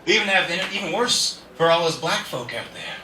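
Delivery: ordinary talk, nothing marked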